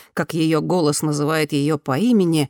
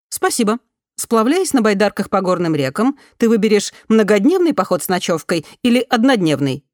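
higher, louder, polished newsreader tone; news reader voice; 4 semitones higher, 4 dB louder than usual. A narrator's reading. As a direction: read it like a news bulletin. Голос системы спрашивает. (голос диктора новостей)

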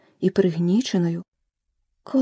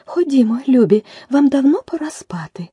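higher, louder, quivering mother-bird call; trembling voice; 8 semitones higher, 6 dB louder than usual. A narrator's reading. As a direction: call the little ones in a tremble. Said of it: "тремтливим голосом". (тремтливим голосом)

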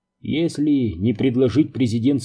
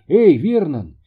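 higher-pitched hail to a colleague; calling out; 6 semitones higher, 4 dB louder than usual. A narrator's reading. Рут hailing a colleague across the lab, calling out. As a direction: call over with a mild narrated shout. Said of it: "крикнула Рут".

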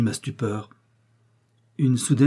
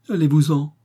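higher and louder, a hint of tearful pleading; through sobs; 4 semitones higher, 5 dB louder than usual. A narrator's reading. Ashamed widow sending the young man away, lightly tearful plea. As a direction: push him away with a choked, understated sob. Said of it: "murmura-t-elle à travers ses sanglots".

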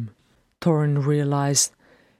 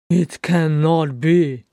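loud, slow disbelief; aghast; 6 dB louder, slower than usual. A narrator's reading. Thorin refusing to believe it, aghast exclamation. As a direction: draw it out in horrified denial.

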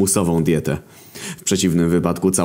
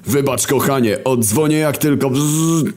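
higher, slowing into fake static; War hanging up; 8 semitones higher than usual. War faking static to hang up on girlfriend. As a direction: trail off into a buzz.

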